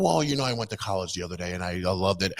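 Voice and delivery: low, soft prim imitation; mock proper voice. Vlogger mocking politician's proper act, low and soft. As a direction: put on a polished, quiet college tone.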